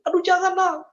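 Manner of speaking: high, loud cry of protest; retold shout. Pleading with his past self not to sin, retold shout. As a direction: cry out to stop your own self. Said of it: "Aku teriak tapi nggak didengar".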